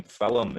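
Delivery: Film said as "fil-um" with a slipped-in vowel; Scots two-syllable film. Shown as a demonstration. Teacher showing Scots singers the vowel in Film. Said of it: "it comes in between them".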